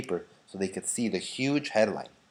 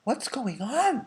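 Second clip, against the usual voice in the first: high, worried townsfolk cry; 13 semitones higher, slower than usual. A narrator's reading. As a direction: call out in puzzled alarm. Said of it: "they cried".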